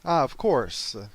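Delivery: ordinary talk, nothing marked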